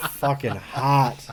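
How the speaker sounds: ordinary talk, nothing marked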